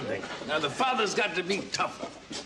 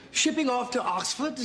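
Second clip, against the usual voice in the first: higher, put-on English accent; 4 semitones higher than usual. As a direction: demonstrate a hammed-up English accent. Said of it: "and have an English accent".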